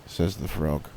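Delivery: plain reading voice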